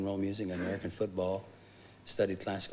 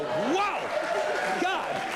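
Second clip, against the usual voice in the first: much higher, louder mock yelp of shock; astonished cry; 4 dB louder than usual. Retelling the goat charging the hog, high shocked yelp. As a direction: yelp it out like a stunned farm kid. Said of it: "and I'd go".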